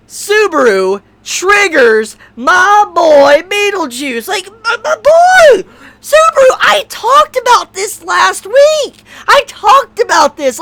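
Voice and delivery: climbing into a cracked, near-screaming rant; exasperated outburst. Real, not acted. Fan yelling at Subaru for tormenting Beetlejuice, exasperated shouting. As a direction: shout it out like a fan betrayed by his favourite.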